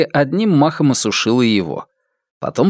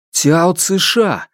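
worried call; 6 semitones higher, much slower than usual. A narrator's reading. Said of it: "заволновался Цзиньтун".

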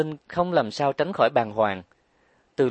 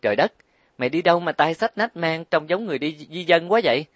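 higher exclamation of astonishment; astonished; 6 semitones higher, 3 dB louder, faster than usual. A narrator's reading. Trang Đài seeing Linh's suitcases, astonished. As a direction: gape at all the luggage. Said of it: "Cô trố mắt nhìn bạn".

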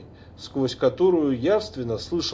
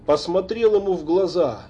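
ordinary delivery, nothing marked

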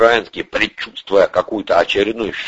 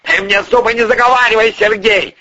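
fast, high, scornful retort; impatient, high-pitched snort of contempt; snorting dismissal; 14 semitones higher, 4 dB louder than usual. A narrator's reading. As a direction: snap back dismissively at raised pitch. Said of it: "фыркнул Порешайло".

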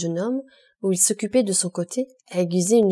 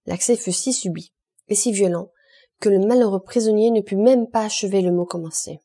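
ordinary delivery, nothing marked

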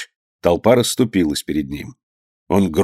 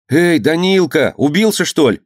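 louder call; 7 semitones higher than usual. Acted. Boy calls to his fallen friend below, louder call. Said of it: "крикнул он погромче".

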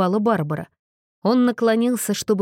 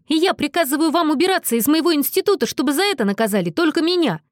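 high, rapid, commanding outburst; pitched-up, hurried command; exclaiming; 7 semitones higher, faster than usual. A narrator's reading. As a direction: order them out urgently. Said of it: "воскликнул он".

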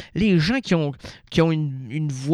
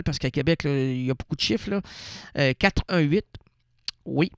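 usual speaking voice

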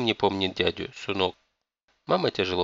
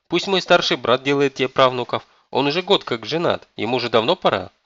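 higher, louder pleading objection; aggrieved grandson protesting; indignant protest; 5 semitones higher, 6 dB louder than usual. A narrator's reading. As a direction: argue back, wail easing off. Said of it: "искренне возмутился Дживон и завопил".

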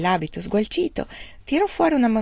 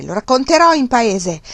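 loud, higher threat; repeated threat; 5 semitones higher, 7 dB louder than usual. A narrator's reading. Uncle repeats his threat, loud. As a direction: keep hammering the threat. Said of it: "continuò a ripetere".

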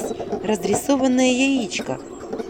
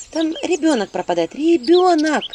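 high louder urgent plea; 7 semitones higher, 5 dB louder than usual. Acted. Heroine urging the spirit, a child is lost. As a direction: press the urgency.

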